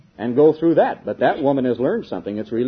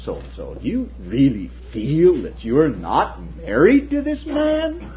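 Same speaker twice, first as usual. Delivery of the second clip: slow, low, mocking lawyer's sneer; sarcastic; 4 semitones lower, 3 dB quieter than usual. Lawyer ridicules the woman on the stand, sarcastic and slow. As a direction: drag it out with scorn, lower than usual. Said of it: "He said sarcastically".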